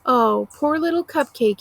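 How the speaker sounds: mock her like a child. A narrator's reading. Driver jeering at the pleading woman, mocking. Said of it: "he says in a mocking tone".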